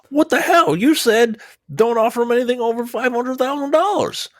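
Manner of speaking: high, outraged mock protest; outraged young lawyer. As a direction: squeal like a shocked junior lawyer.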